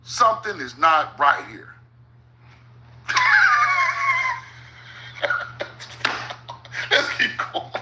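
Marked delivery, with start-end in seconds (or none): 3.1-4.4 sudden shrieking laugh of disbelief; real laugh at the absurd map. Real, not acted.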